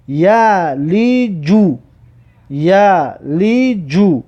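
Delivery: slow, evenly spaced syllables; drawn-out model reading. Shown as a demonstration. Teacher models a word for learners, slow and drawn out. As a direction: stretch "یلیجو" syllable by syllable.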